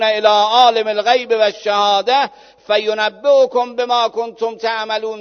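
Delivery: loud, high sermon climax; preaching at full force. Acted. Preacher at full force, Quran story. (موعظه با صدای بلند و پرشور)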